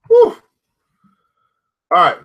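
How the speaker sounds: ordinary delivery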